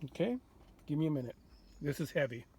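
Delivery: ordinary talk, nothing marked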